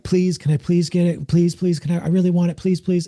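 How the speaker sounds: high-pitched pleading, put on; mock whiny begging, pitched well up; begging child, imitated. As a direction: mimic a child begging insistently. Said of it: "The next card says beg".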